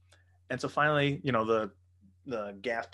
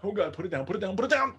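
mock terror; 8 semitones higher, faster than usual. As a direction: replay the panic, climbing with each repeat. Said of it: "one of the most terrifying things".

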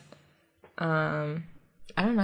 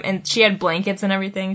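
ordinary talk, nothing marked

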